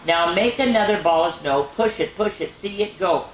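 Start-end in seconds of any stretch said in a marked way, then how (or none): none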